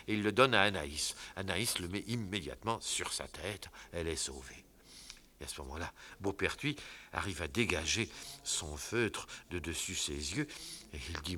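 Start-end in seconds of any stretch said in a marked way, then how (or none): none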